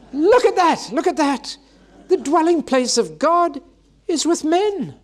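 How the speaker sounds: high, loud cries of amazement; an awestruck angel who can't believe it; astonished crying out. Acted. Angel announcing God dwells with men, high and loud in amazement. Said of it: "the angel cries out".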